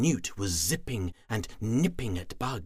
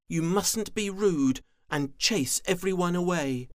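scolding; 10 semitones higher, 4 dB louder than usual. Acted. Pretty Frog scolds.

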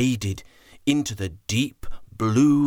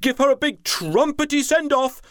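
higher, louder eager announcement; excited chief elephant; 12 semitones higher, 4 dB louder than usual. Acted. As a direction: announce it with delight. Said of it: "He became very excited".

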